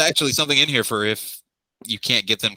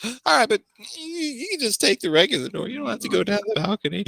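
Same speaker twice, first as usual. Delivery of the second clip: put-on very high, sing-song young woman; high girly voice; 10 semitones higher than usual. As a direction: chirp it like a spoiled girl. NPC girl high voice.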